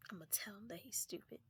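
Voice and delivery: conspiratorial whisper; hushed scheming. Retold or imitated. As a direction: whisper the plan like a secret. Whispering plan to friend.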